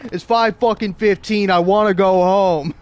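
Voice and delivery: over-the-top loud gripe; hammed-up whiny complaint. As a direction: moan about wanting to leave. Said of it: "hamming it up".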